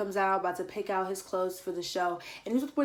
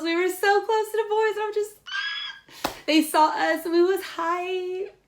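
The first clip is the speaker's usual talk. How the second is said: much higher, loud excited voice with a shriek; excited squeal; 11 semitones higher, 5 dB louder than usual. Real, not acted. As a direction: relive the thrill of seeing them up close. Sees the boys at the concert.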